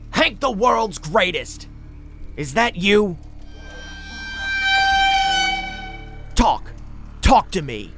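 high, loud shouting; calling out. A narrator's reading. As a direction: yell up into the room, demanding answers. Calling out to distract the voice.

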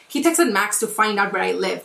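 ordinary talk

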